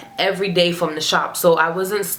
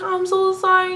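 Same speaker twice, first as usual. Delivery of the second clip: mock tearful; 12 semitones higher than usual. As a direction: whimper it in a put-on high voice. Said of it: "I start crying and getting emotional".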